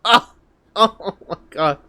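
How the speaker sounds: ordinary delivery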